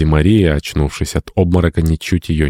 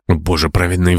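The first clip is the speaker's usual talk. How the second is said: ordinary delivery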